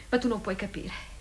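ordinary talk

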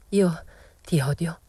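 slow, halting, mildly breathless; a slowed, lightly faltering confession of hate; stammering, breathless. A narrator's reading. Rosetta stammering at Momina, understated panting. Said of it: "balbettò Rosetta ansante".

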